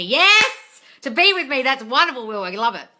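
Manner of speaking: high, loud whoop of delight; delighted shout. Real, not acted. Coach cheering a viewer's comment, loud delighted shout.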